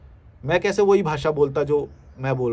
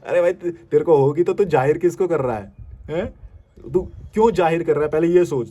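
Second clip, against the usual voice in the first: louder scolding challenge; 5 dB louder than usual. Real, not acted. Vlogger scolding a commenter, loud challenge. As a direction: confront him head-on.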